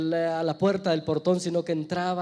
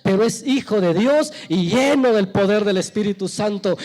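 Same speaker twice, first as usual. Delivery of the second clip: insistent preaching that builds louder; impassioned preaching; 2 semitones higher, 5 dB louder than usual. Real, not acted. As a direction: drive each phrase harder, building to a loud peak. Preaches at full force.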